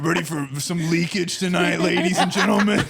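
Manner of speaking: hammed-up ring announcer hype; booming wrestler voice. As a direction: boom it out like a wrestling announcer, laughing. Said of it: "In your wrestler voice".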